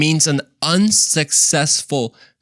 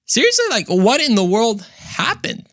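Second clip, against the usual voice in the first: high, disbelieving seriously; shocked disbelief; stunned at the news; incredulous; 10 semitones higher than usual. Real, not acted. Shocked at daughter's turn.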